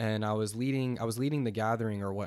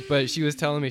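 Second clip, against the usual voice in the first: ordinary talk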